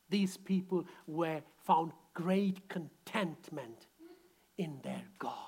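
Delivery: soft and slow, trailing to a whisper; quiet, slow. Shown as a demonstration. Preacher softly stating the point before the congregation repeats. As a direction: hold it low and slow, almost whispered. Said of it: "Come on, shout it out".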